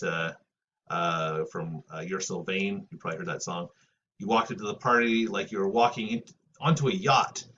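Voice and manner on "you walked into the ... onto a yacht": lyric read out with rising lilt; reading lyrics aloud. Acted. Teacher reads song lyric to class, lightly recited. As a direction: recite the lines a touch theatrically.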